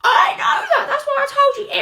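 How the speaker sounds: high, loud exasperated protest; shouting back at the boss, exasperated. Acted. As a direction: snap back in frustration.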